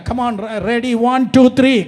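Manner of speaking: counting in. Real, not acted. Counting in the congregation.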